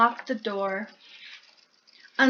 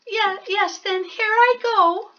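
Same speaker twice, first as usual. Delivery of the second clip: high, hesitant little mouse voice; timid stammering mouse; 9 semitones higher, 3 dB louder than usual. Acted. Mouse nervously asking Bear for cheese, high. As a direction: stammer out a request. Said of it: "said the mouse".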